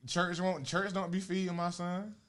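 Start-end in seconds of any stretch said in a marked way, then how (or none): none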